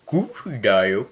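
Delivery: low, loud cartoon dog voice; amateur low-pitched cartoon character impression; Goofy. Shown as a demonstration. Podcaster trying an impression they have never done, low goofy voice. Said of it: "グーフィーの真似します".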